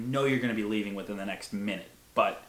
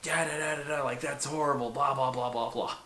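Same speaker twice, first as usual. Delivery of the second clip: higher-pitched mock ranting, running into nonsense syllables; mock fit; 5 semitones higher than usual. Retelling grandma at the door, throwing a mock fit. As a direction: imitate someone scolding, blah-blah style. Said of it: "she starts throwing a fit".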